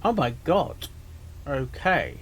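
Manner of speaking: usual speaking voice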